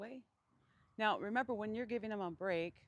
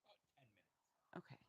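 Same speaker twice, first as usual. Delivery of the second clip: whispering; 6 semitones lower, 16 dB quieter than usual. Real, not acted. Cameraman answers off to side.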